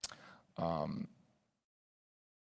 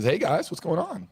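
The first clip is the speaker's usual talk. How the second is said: casual Brian greeting; 12 semitones higher, 8 dB louder than usual. Pretend. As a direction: pretend Brian, much higher and louder, breezy hello. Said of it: "pretend time here for a moment".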